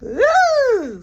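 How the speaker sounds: high loud drawn-out ooh; mock-knowing high ooh; teasing ooh. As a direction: tease them with a long ooh. Teasing reaction to friend leaving.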